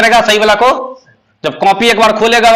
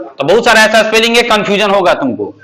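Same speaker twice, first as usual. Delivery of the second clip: ordinary delivery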